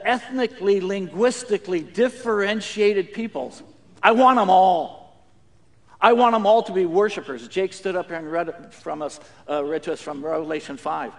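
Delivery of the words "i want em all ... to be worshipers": emphatic claim. Retold or imitated. God's desire voiced.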